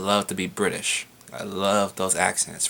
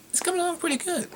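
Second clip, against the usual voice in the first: much higher, quick squeaky put-on voice; silly squeaky voice; faster than usual. Answering the fan's college question in a silly squeaky voice. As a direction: chirp it out like a cartoon kid.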